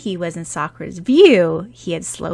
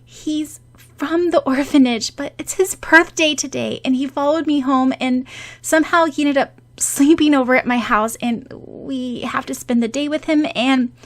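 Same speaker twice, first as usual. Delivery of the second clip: nervous rambling; 7 semitones higher, 4 dB louder than usual. Acted. Nervous rushed explanation.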